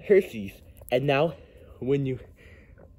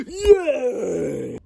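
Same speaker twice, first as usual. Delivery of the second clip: excited cheer; 9 semitones higher than usual. Acted. Delighted at chocolates.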